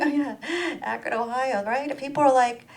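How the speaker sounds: usual speaking voice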